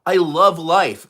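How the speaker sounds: loud, high, gushing delight at being alive; mock cheerful wake-up. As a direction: burst out with joy at a new day. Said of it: "you're like".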